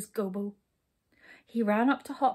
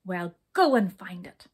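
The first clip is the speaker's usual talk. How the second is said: loud, gruff order; storming; 3 semitones lower, 7 dB louder than usual. A narrator's reading. King sending brownies to find goodness, storming. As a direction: order them off angrily. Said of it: "stormed the king".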